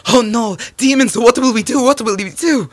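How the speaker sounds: high, loud wail of alarm; panicked villagers. Acted. Frightened villagers cry out in story.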